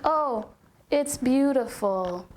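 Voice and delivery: slow, drawn-out, hollow praise; mock half-hearted compliment. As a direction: say it like you don't mean it. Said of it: "the most basic, mundane look on her face".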